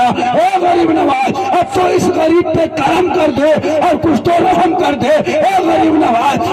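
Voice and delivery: drawn-out, high, loud wail of a prayer; wailing plea. Retold or imitated. The poor man begs the saint for mercy, wailing. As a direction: stretch each word into a sung cry. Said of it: "रो रो के दिल से दुआ मांगा".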